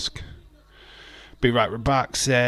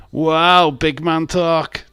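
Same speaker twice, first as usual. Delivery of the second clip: mock impressed; 7 semitones higher, 7 dB louder, much slower than usual. Real, not acted. Mocking a chatter's boast.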